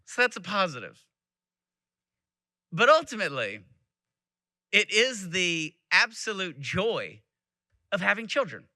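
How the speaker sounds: ordinary talk, nothing marked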